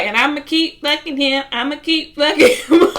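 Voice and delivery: loud, high taunting comeback, voice climbing; spiteful retort. Retold or imitated. She quotes the girlfriend stooping to his level, loud taunting retort. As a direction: throw it back at him to hurt.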